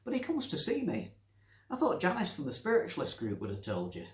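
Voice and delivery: put-on high old woman talking down; patronising old Nancy. Nancy patiently explaining to the doctor, patronising. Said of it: "looked at him like he was dim".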